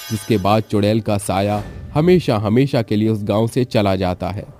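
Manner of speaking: usual speaking voice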